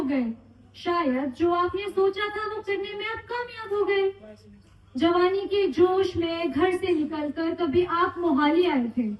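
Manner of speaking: measured, declaimed poem with deliberate pauses; heartfelt stage recital; poem recitation. Acted. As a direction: recite a tribute poem to an audience, each line rounded off. Recites own retirement poem for father.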